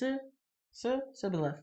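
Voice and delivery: sibboleth said with a plain s; sibboleth with a lone s at the start; s instead of sh. Shown as a demonstration. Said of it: "They could only say s".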